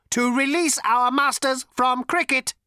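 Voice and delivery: high, loud, mechanical robot voice; cold, lifeless robot. A narrator's reading. Robot confronts Zaphod about his gun, cold and lifeless. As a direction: ancient robot with a cultured rasp, pitched high. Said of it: "cold, sleek, and lifeless".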